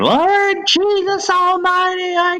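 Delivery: high, hammed-up preacher casting out demons; mock exorcism. Grandmother exorcising grandson in restaurant, hammed-up preaching. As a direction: rebuke the demon like a tiny old grandma. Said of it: "starts trying to exorcise me".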